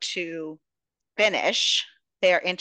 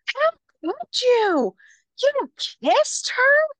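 shrill high shrieking outrage, slow and loud; hysterical sister; 6 dB louder, slower than usual. Retold or imitated. Podcaster voicing the furious sister at the husband, high shrieking wail. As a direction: wail it like a betrayed drama queen. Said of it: "She's so mad. She's like".

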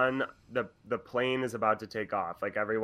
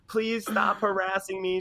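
high-pitched mock-plaintive lady; imitated indignant passenger, pitched well up; complaining woman; 9 semitones higher than usual. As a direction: plead in a put-on higher voice. Woman complaining in retold argument.

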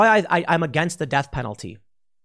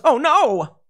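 high, louder cartoon panic; a bad guy suddenly scared; hammed-up yelp of alarm; mock fright; 10 semitones higher, 5 dB louder than usual. Criminal faces armed woman.

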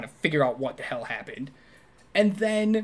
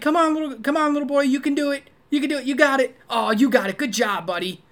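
high, loud imitation of encouraging a little kid; cheering on a child; 9 semitones higher, 6 dB louder, faster than usual. Man coaching a little boy at racquetball, excited high kid-talk. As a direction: egg on a small boy, then praise him. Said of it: "that's how you talk to kids".

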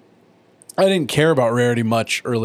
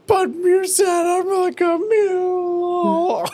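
much higher, slow fake-sobbing whine; hammed-up crying pony; mock bawling; much slower than usual. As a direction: bawl it like a spoiled pony. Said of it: "she starts bawling".

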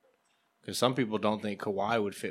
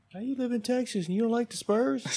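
mock Spurs fan; 13 semitones higher than usual. Mocking Texan Spurs-fan family.

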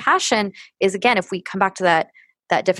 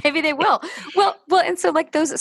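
laughing; 9 semitones higher than usual. Real, not acted. Joking about spa sponsor.

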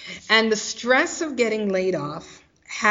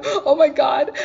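higher, louder tearful cry of despair; retelling her desperate plea to a praying friend, half-crying; teary plea; 7 semitones higher, 4 dB louder than usual. Retold or imitated. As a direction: plead like you're about to cry.

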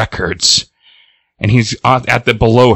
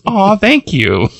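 high, drawn-out gushing thanks; flattered; 10 semitones higher, slower than usual. Retold or imitated. Boom Boom thanks Jubilee for an insult, flattered. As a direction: coo it slowly as if complimented.

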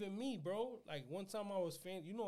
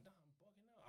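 very soft self-talk; under the breath, to yourself; disbelieving aside; 4 semitones higher, 22 dB quieter than usual. Retold or imitated. He spots the rapper at the mall, hushed disbelief. Retold disbelief on spotting the star.

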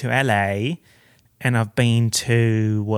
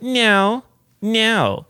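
emphatic no; 9 semitones higher, 6 dB louder than usual. Pretend. Answering her own question emphatically.

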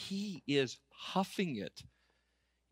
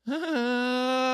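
drawn-out, high-pitched "aah"; long high "aah"; 10 semitones higher, 4 dB louder than usual. Retold or imitated. Son reacting to his sweating dad, long high "aah". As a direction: hold one high note of mock realization.

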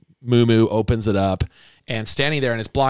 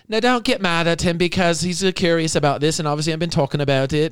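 put-on slipping British accent; 8 semitones higher than usual. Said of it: "am I British now?".

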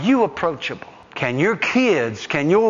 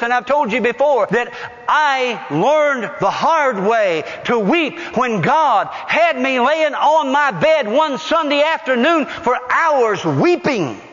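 ordinary talk

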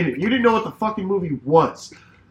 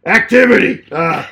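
lightly voiced Bert, louder and higher reading; a hint of Bert, read out loud and high; Bert impression; 7 semitones higher, 8 dB louder than usual. Reading Bert's spreadsheet to the hosts, a loud mock Bert voice. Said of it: "I'll do my best Bert impression".

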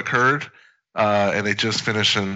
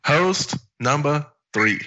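copying the cadence; 6 semitones higher, slower than usual. Retold or imitated. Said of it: "I like the cadence".

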